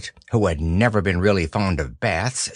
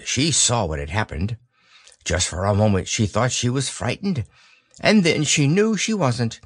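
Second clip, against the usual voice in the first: plain reading voice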